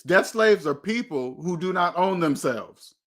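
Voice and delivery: ordinary talk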